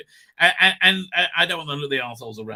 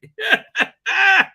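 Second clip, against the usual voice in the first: real high squeal of laughter; 14 semitones higher, 10 dB louder than usual. Real, not acted. Streamer cracking up at own joke with a squealing laugh. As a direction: burst into a high helpless laugh.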